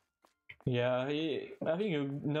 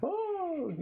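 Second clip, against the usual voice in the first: high, sudden surprised oh. Real, not acted. Streamer hears a viewer lives in Louisiana, high surprised oh. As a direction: jump way up in pitch on oh.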